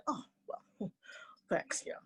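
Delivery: soft little thanks with a small laugh; bashful cricket. Acted. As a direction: shrug off the praise quietly. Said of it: "Cricket said".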